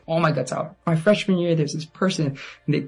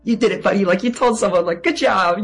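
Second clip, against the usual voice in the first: proud pep talk, higher than usual; self-congratulation; 6 semitones higher, 4 dB louder than usual. Retold or imitated. Speaker congratulates themselves on finally telling someone, excited. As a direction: cheer yourself on, high-pitched.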